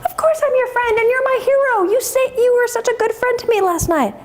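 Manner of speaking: high, effusive wolf voice; grateful wolf. Acted. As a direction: gush high-pitched to a new friend. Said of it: "And the wolf says".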